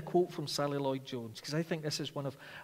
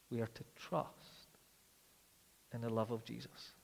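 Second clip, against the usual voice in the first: tender quiet preaching; 3 semitones lower, 5 dB quieter than usual. Acted. Preacher comforting tired Christians before sleep, soft and tender. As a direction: ease them toward rest, very softly.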